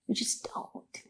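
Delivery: firm whisper to the listener; stern whispered warning about lying to your lawyer. Real, not acted. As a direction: drop to a whisper and mean it. Stern advice whispered aside.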